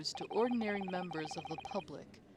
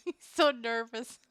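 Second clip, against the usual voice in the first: laughing; 8 semitones higher, 6 dB louder than usual. Real, not acted. Laughing at nervous character.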